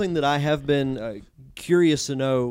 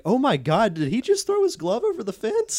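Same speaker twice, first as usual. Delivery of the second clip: a gasped, high-pitched did-he-really question; shocked disbelief; 10 semitones higher than usual. Retold or imitated. Press box staff stunned by the glove toss, high surprised gasp.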